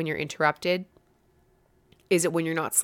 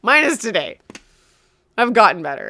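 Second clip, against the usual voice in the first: laughing, higher and louder; 4 semitones higher, 7 dB louder than usual. Real, not acted. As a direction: repeat it laughing at yourself. Repeated through laughter.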